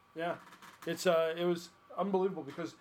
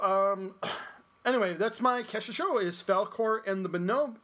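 ordinary talk, nothing marked

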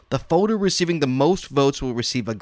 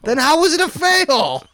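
incredulous outburst; 11 semitones higher, 5 dB louder than usual. Real, not acted. Host incredulous at contest photo.

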